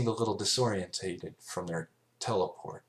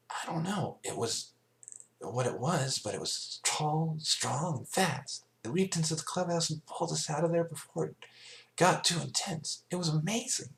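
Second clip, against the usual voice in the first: put-on much higher, wide-eyed child voice; building up the hero; awed filly; 10 semitones higher than usual. A narrator's reading. Scootaloo telling the adults about her rescuer, awed.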